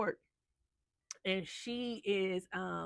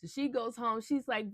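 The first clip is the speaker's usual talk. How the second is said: ordinary talk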